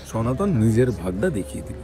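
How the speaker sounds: ordinary delivery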